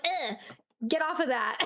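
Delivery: hammed-up grossed-out protest; mock disgust. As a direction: shoo a hand away in comic revulsion. Acted disgust at unwanted touch.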